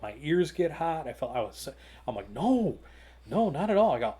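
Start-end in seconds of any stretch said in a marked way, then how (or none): 2.3-3.9 retold, higher and louder protest; flustered denial. Accused booth vendor answering the celebrity, flustered denial.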